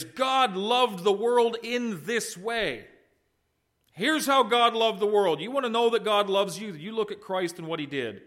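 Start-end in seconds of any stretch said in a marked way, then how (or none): 0.0-2.9 slow, deliberate, stress on "God" and "this way"; meaning of the verse. Shown as a demonstration. Preacher giving the Greek sense of the verse, slowly. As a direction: lay out each word plainly. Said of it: "the statement is".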